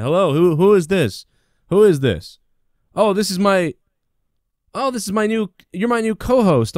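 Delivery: high, loud, overexcited hello; fake excited phone pickup. Host acting thrilled at his fake caller. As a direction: ham up surprise at a staged call. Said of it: "I was trying to do a, uh, gimmick".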